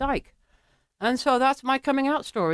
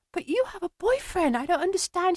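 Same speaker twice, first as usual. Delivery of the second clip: bewildered; 7 semitones higher, 4 dB quieter than usual. Retold or imitated. Retold bewildered reply to crying woman.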